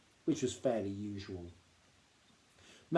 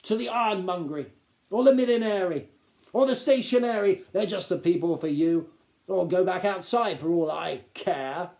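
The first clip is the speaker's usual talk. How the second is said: sarcastic; 6 semitones higher, 8 dB louder than usual. A narrator's reading. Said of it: "said the Duke sarcastically".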